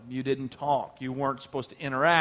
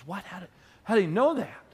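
startled woman; 4 semitones higher than usual. Retold or imitated. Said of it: "And she goes".

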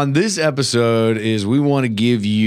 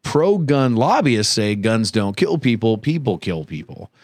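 usual speaking voice

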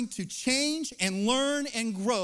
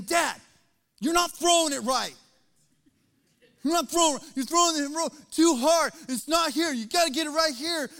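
a son's whiny complaint, louder; frustrated son; 4 semitones higher, 5 dB louder than usual. Retold or imitated. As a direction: complain to Dad like a sulking kid. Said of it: "mad and frustrated".

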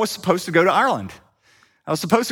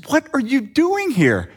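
higher and slower, a surprised question; astonished question; 8 semitones higher, slower than usual. Retold or imitated. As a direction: play a friend puzzled to find him there. Colin astonished at the door.

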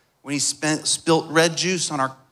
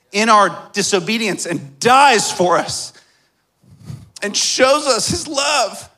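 raised, high-pitched preacher's proclamation; impassioned preaching; 6 semitones higher than usual. Real, not acted. Preacher telling church of God's love, impassioned preaching.

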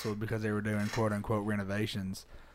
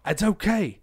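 yelled rallying call over the room; high, loud pep talk to the boys; yelling; 6 semitones higher, 6 dB louder than usual. Retold or imitated. Comic rallying a stunned crowd, yelling. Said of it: "yell at them".

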